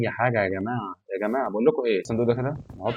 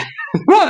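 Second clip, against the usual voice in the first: sudden very high laugh; burst of laughter; 6 dB louder than usual. Real, not acted. Gamer laughing while being levitated, high laugh. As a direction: break out laughing.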